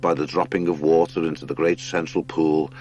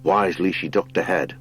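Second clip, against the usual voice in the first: ordinary delivery, nothing marked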